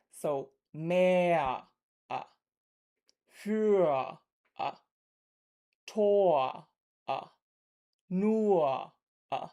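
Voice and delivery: mehr, für, Tor, nur gliding into a drawn-out uh; mehr, für, Tor, nur with R as a drawn-out uh vowel; vocalic r drawn out. Shown as a demonstration. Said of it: "glides into the short vowel sound".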